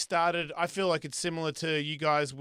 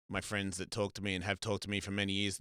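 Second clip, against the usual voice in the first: normal talking voice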